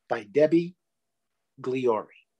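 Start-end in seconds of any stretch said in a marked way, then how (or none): none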